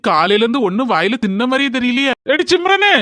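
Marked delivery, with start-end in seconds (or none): 2.3-2.9 calling out. Acted. Husband calling wife from outside.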